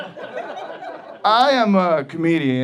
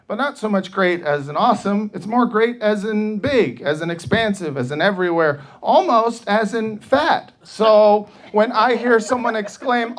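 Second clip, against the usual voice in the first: ordinary delivery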